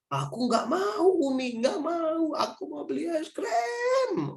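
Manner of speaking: put-on high, slow crying child demanding ice cream. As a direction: wail and whine for the ice cream.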